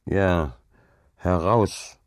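understated, grumpy mumble; grumbling. A narrator's reading. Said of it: "brummte Hauke".

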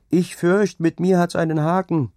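high, loud call. A narrator's reading. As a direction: call out to someone approaching. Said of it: "rief er ihm entgegen".

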